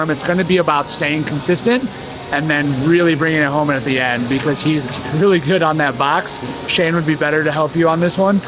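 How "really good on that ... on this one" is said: ordinary talk, nothing marked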